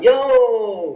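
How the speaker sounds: high, drawn-out mock holler; goofy phone greeting. Cricketer answering what he thinks is a friend's call, goofy high greeting. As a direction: answer the phone with a silly whoop. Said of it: "you'd say something stupid when you answer".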